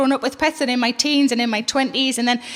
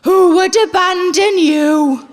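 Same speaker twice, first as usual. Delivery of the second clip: slow, loud, higher pleading to the cat; imitating fella; 4 semitones higher, 5 dB louder, much slower than usual. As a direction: drag it out, tender mock plea. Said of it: "he looks her right in the face and he goes".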